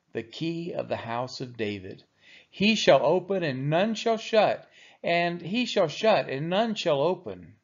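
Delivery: normal talking voice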